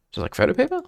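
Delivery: high incredulous question; surprised echo. Acted. Echoes Lyle's odd comparison.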